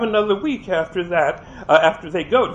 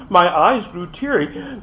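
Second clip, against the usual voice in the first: plain reading voice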